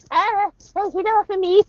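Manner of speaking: high, whiny mock plea; hammed-up panic of someone being choked; mock panicked victim. Showing women the useless plea when choked, mock panic. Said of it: "do not waste your time putting your hands on them".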